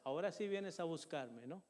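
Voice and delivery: hushed, sneering taunt from the enemy; a hint of a mocking devil, said quietly; the enemy's mocking voice. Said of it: "Mire el enemigo".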